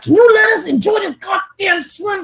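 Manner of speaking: a fed-up parent yelling at pool staff to let them swim; retold angry shout. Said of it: "I was all mad".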